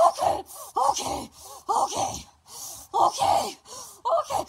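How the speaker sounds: high, breathless frantic okays; panicked okays. Retold or imitated. Mother in labour gasping okay at her man. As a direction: gasp out okays mid-labour. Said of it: "She goes".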